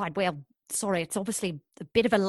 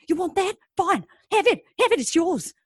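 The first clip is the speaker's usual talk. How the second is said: high-pitched, fed-up surrender; exasperated; 9 semitones higher, 4 dB louder than usual. Acted. Cafe worker hands blackmailer the takings, exasperated. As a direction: fling each short phrase at him.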